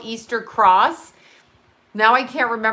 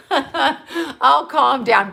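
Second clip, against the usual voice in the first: slow, higher, laughing it out; laughing through words; 4 semitones higher, 4 dB louder, much slower than usual. Real, not acted. Repeating her joke to viewers, laughing through it. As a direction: say it while cracking up.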